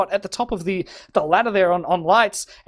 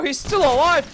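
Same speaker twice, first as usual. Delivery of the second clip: excited shout; 5 semitones higher than usual. Real, not acted. Caster amazed a player survived, excited shout. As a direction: call it out in disbelief.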